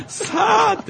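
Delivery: laughing shout. Pretend. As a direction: yell it out while laughing. Mock cheer, laughing shout. (笑いながら叫ぶ)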